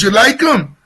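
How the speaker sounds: a hint of a Jersey Italian uncle on the phone; Tony Danza-style uncle. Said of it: "he talks like Tony Danza".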